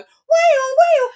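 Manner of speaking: very high, loud mimicked siren; imitated alarm wail. Host imitating the alarm going off, piercing mock siren. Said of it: "So the alarm's going".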